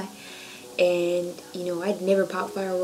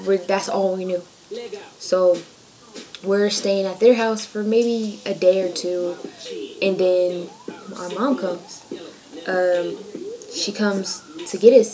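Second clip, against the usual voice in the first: normal talking voice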